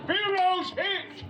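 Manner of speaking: high, slow movie shout; slow, high dramatic call; shouted line. Retold or imitated. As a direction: yell it like a hit comrade. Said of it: "And Flash Gordon says".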